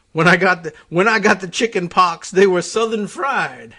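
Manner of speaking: loud Southern bluster; Senator Claghorn. Acted. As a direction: deliver it as a punchy Southern senator's boast. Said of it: "senator's other anti-Northisms included".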